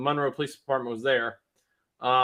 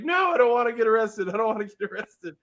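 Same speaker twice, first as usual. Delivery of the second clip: higher, whiny mock crying plea; imitating crying son; 7 semitones higher than usual. Son carried back, pleading through mock tears. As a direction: whimper it like a scared little kid. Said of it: "he's, like, crying".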